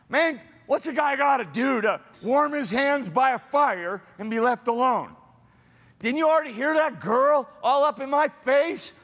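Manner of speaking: high, exasperated pleading guy at the fire; pleading Peter. Retold or imitated. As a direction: whine it like a cornered man begging to be left alone. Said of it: "I hear in his voice a pleading".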